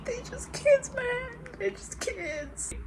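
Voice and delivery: high, cracking tearful plea; tearful cry. Real, not acted. Reader crying over the kids, high and breaking.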